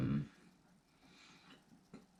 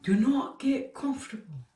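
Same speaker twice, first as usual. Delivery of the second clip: high, slow Scottish Lord Farquaad proclamation; better Scottish accent; 8 semitones higher than usual. Pretend. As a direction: announce it like a pompous Scottish lord. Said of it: "I could do so much better".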